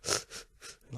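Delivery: quick mock sobbing sniffs; sharp little crying sniffs, put on; imitated crying sniffles. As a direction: imitate her sniffling as she cries. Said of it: "like, you heard her".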